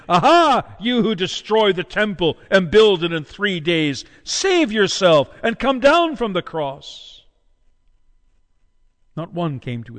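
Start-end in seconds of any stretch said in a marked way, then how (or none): 0.1-7.2 mocking. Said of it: "blasphemed him, wagging their heads".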